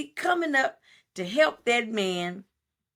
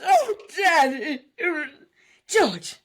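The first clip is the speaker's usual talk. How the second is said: high, slow mock crying girl; whiny old movie girl; 5 semitones higher, 4 dB louder, slower than usual. Imitating the little girl in the old film, high mock crying. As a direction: whimper it like an old film child.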